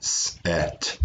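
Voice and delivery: "set" in soft, separate sounds; "set" broken up sound by sound. Shown as a demonstration. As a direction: hiss the s apart from the rest of "set". Teacher taps out the sounds.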